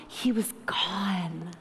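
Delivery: slow, hushed near-whisper; heavy, breathy hush; hushed grief. Real, not acted. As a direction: let the words sink into a slow whisper. Grief for Jesus being gone.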